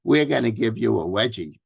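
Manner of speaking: lightly imitated Boston tough kid; mock schoolboy threat in a Boston accent. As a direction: put on a slight Boston accent. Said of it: "here's my Boston accent here".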